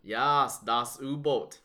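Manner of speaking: hammy fake German accent on das U-Boot; bad German accent. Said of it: "That was a really bad German accent".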